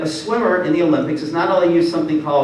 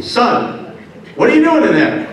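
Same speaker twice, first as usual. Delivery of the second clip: raised, loud call through a door; dad checking up, high and pressing; mock stern dad; 7 semitones higher, 3 dB louder than usual. Dad calling to son behind the door, mock demanding.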